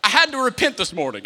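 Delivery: loud, impassioned sermon delivery; fiery preaching. Acted. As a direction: preach it hard to the crowd. Preaching with full force.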